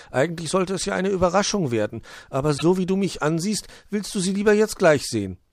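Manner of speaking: high storybook elf voice; relenting little elf. Acted. Puh relents in read story.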